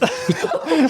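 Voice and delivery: a sudden high laugh of disbelief; high, breathy burst of laughter. Real, not acted. Laughing at friend's remark.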